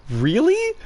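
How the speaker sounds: high, rising "Really?" in disbelief; incredulous. Retold or imitated. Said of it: "I'm kind of exasperated".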